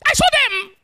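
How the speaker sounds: high, loud crying complaint; jealous brother's outcry. Retold or imitated. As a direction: wail and shout the unfairness.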